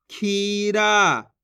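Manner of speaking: खीरा very slow, both vowels held; syllables drawn out. Shown as a demonstration. Teacher reads the word, खीरा drawn out. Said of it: "जिस तरीके से मैं खींच खींच के पढ़ रहा हूँ".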